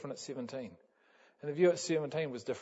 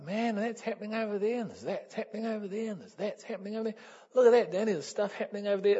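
high, quickening excited chatter; 9 semitones higher, 3 dB louder, faster than usual. Retold or imitated. Atop the tower to Danny, high excited babbling. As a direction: point everything out like a thrilled kid. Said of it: "I'm getting all excited".

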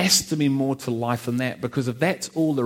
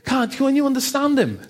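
mocking local kids; 12 semitones higher than usual. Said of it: "people are going".

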